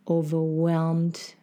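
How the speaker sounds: usual speaking voice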